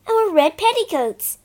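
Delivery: Bobby hitting on a plan; exclaiming. Acted. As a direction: burst out with the idea. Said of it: "Bobby exclaimed".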